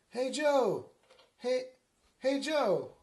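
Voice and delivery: high, loud holler to a husband; hammed-up high call. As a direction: call to get his attention. Mary calling out to husband.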